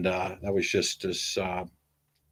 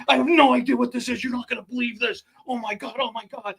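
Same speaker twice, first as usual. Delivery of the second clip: high, frantic hammed-up fright; panicked last message. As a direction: babble like you are recording your own death. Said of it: "what he probably thinks is his death".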